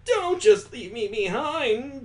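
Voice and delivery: high, drawn-out plea to wait; calling after them. Acted. Girl left behind calling after her friends. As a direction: call out slowly after friends walking off.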